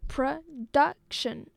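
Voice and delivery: production in three separate slow beats; syllable by syllable. Shown as a demonstration. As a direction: slowly split production into pro, duc, tion. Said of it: "a three-syllable word".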